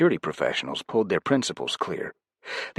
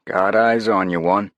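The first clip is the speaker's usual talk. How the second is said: louder clipped radio check; louder voice calling in over the radio; 3 semitones higher, 7 dB louder than usual. A narrator's reading. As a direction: call it in over comms. Yun radioing the team.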